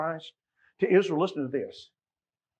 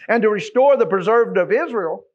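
forceful scripture reading; 6 semitones higher, 11 dB louder than usual. Acted.

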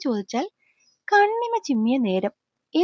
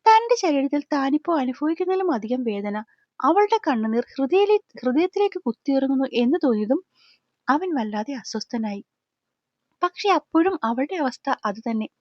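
plain reading voice

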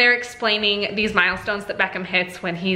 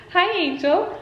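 high, slow greeting after a laugh; 8 semitones higher than usual. Real, not acted. Mom greeting her daughter, high and slow baby talk.

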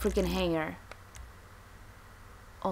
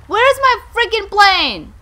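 high, loud, drawn-out shout; angry yelling; 10 semitones higher, 13 dB louder, slower than usual. Pretend. Player finding the plane isn't theirs, angry yelling. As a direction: wail the demand in outrage.

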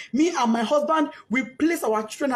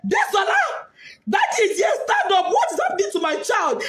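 retold scream; 6 semitones higher than usual. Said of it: "He screamed, shouted".

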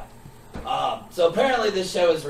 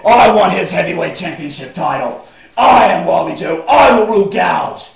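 loud, barking wrestler promo; shouted wrestling promo; 2 semitones higher, 10 dB louder than usual. Acted. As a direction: yell the challenge at the crowd.

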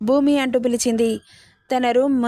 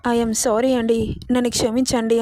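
usual speaking voice

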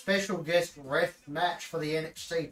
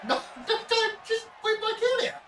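pleading. Real, not acted. Pleading at game.

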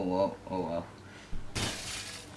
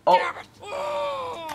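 rising panicked oh oh oh; real panic climbing high; panicked cries; 3 dB louder, slower than usual. Real, not acted. Tense moment in game.

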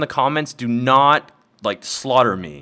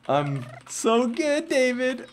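smug gloating; 13 semitones higher, slower than usual. Real, not acted. Gloating at beaten friend.

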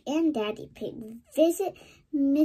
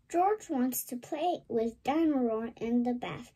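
ordinary delivery

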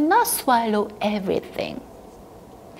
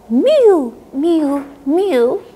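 meowing; 3 semitones higher, 4 dB louder, slower than usual. Acted. Cat noise.

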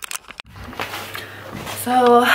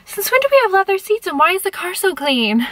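puzzled; 9 semitones higher, faster than usual. Retold or imitated. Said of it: "I was like".

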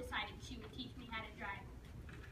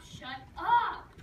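louder, fed-up repeat; teen silently fed up with mom, louder; louder annoyed shut up; 3 semitones higher, 9 dB louder than usual. Retold or imitated. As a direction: snap it harder the second time. Thinking irritated at mom.

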